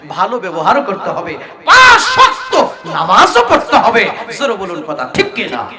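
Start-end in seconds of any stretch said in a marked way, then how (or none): 1.6-4.1 loud, high preacher's shout; emphatic exhortation. Real, not acted. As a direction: drive the command home to a crowd. Exhorts the congregation. (জোর গলায় ওয়াজের হুঁশিয়ারি)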